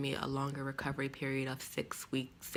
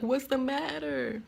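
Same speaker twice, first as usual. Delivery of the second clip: high, slow, concerned question; worried coworker; 10 semitones higher, 4 dB louder than usual. Retold or imitated. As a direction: ask it like a worried colleague leaning in.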